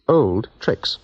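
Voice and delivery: ordinary delivery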